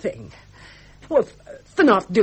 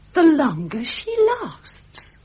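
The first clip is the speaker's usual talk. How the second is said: slow, higher, each word leaned on; pointed repeat; 8 semitones higher, slower than usual. Acted. Vicky to Bertie, pointedly repeating his words, slow stress. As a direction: hold his own words up to him, slowly.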